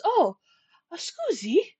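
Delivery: mock Italian, high and light; a big guy's gentle scusi; lightly put-on Italian accent; Italian-ish apology. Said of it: "He goes".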